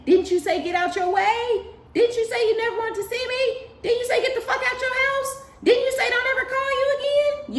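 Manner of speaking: very high, loud, sarcastic questions; taunting throwback. Retold or imitated. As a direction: rub their own words back in their face. Mocking throwback to the hater.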